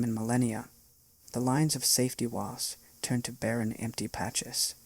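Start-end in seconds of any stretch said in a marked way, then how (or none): none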